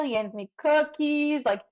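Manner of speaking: ordinary talk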